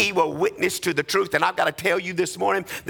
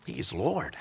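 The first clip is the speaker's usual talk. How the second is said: much softer, lower affirmation; hushed; 10 semitones lower, 8 dB quieter than usual. Acted. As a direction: drop to a near hush. Dropped to a hush.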